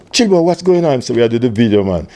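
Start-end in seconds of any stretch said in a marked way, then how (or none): 0.1-1.0 excited call. Retold or imitated. Greeting Chilba at rehearsal.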